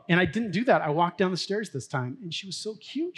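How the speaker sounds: normal talking voice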